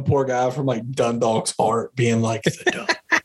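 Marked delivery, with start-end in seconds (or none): 2.4-3.2 laughing. Retold or imitated. Mocking the German tourist.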